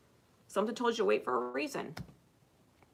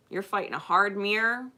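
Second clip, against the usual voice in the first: ordinary delivery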